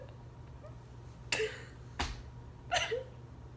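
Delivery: high, soft sob; real breakdown into quiet sobs; crying. Real, not acted. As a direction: give in to a breathy sob. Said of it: "body could take it anymore".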